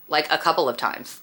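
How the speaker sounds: normal talking voice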